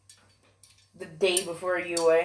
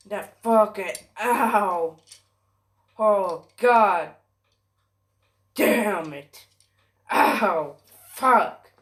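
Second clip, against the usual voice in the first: pained cursing in short bursts; cursing between stabs of pain; real pain; 4 dB louder, much slower than usual. Real, not acted. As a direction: groan and swear through the ache. Still hurting, swearing in pain.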